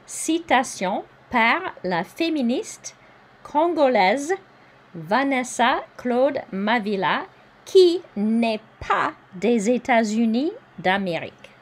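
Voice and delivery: teacher reading French aloud; careful French reading. Shown as a demonstration. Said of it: "I'll say this bottom part one more time".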